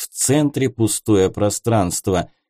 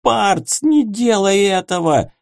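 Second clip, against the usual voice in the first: horrified plea; 9 semitones higher than usual. A narrator's reading. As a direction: plead in horror. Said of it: "в ужасе произнёс Герент".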